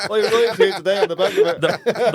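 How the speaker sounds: real laugh through the joke; teasing through a loud laugh. Real, not acted. Host laughing at the bluff.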